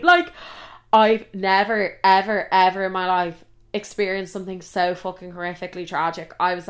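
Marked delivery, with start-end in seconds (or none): none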